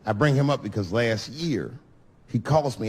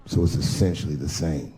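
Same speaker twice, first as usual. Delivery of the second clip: lower, earnest friend; friend voiced low; 9 semitones lower than usual. Nervous groom confiding in Dave, low voice. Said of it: "he corrected me".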